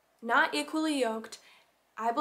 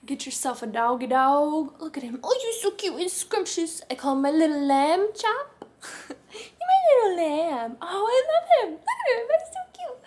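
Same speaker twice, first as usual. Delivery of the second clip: much higher, louder cooing at the dog; 5 semitones higher, 9 dB louder than usual. Real, not acted. Owner coos at her dog in a high baby voice. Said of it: "my voice goes up twelve octaves".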